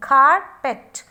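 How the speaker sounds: "Carpet" as a firm, loud "car-pet"; syllable by syllable. Shown as a demonstration. Teacher showing two syllables are there, "Carpet" loudly beaten out as "car-pet". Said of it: "pronounce it properly".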